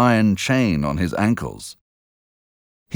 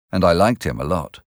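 ordinary delivery, nothing marked

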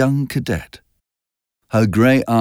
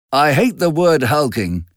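angry; 6 semitones higher than usual. A narrator's reading. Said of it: "interrupted Tom angrily".

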